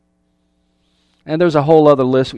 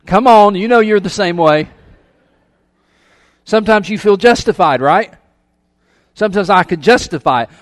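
playful teasing challenge; 5 semitones higher, 6 dB louder than usual. Real, not acted. Preacher teasing the congregation.

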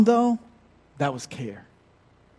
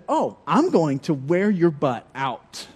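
mom voice building louder and higher; threatening mom; 4 semitones higher, 5 dB louder than usual. Retold or imitated. Mother listing who will spank him, building higher and louder. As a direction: rise through the list of uncles with mock menace. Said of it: "She said".

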